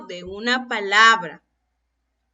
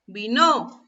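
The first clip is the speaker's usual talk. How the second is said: "Vino" as vi-NÓ, stress on the end; wrong final-syllable stress; 2 semitones lower than usual. Shown as a demonstration. Teacher asks if we say it so, "Vino" stressed on its final syllable. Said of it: "¿Así es como pronunciamos esa palabra?".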